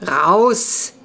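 sleepy, drawn-out protest; groggy shout from bed; drowsy. A narrator's reading. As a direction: groan it out half asleep. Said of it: "tönte es schlaftrunken aus Jonas Bett".

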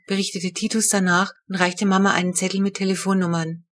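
ordinary delivery

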